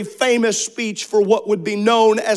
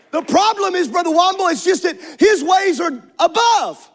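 preaching at full force; 7 semitones higher than usual. Acted. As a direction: lift the church with every line.

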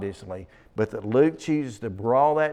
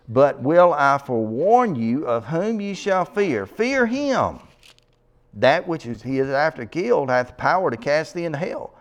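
higher, declaimed Bible verses with swooping stresses; emphatic scripture reading; 3 semitones higher, 4 dB louder than usual. Acted. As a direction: proclaim the verses to a congregation. Reads scripture aloud.

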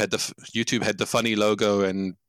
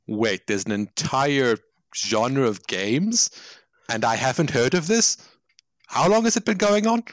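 incredulous discovery; slower than usual. Acted. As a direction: act out a role player's slow, dawning amazement. Role players discovering a whole genre.

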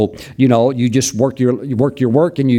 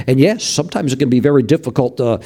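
preaching. Real, not acted. Preaching, slow emphatic build.

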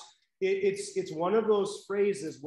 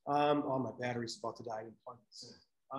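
muttered aside; 7 semitones lower, 7 dB quieter, faster than usual. Real, not acted. Muttered aside about dying laptop.

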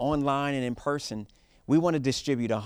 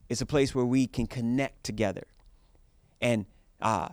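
usual speaking voice

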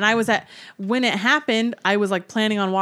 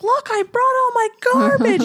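put-on much higher, louder little-kid voice, proudly showing off; proud little boy; 5 dB louder than usual. Mom imitating her son showing off his garbage, proud high kid voice. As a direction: squeak it out like a proud small boy. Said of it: "he's like".

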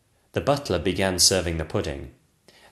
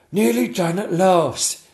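relieved courtier; 10 semitones higher, 6 dB louder, slower than usual. Acted. Chamberlain relieved.

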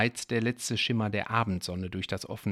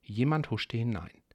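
ordinary delivery

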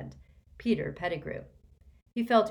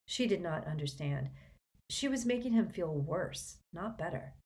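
usual speaking voice

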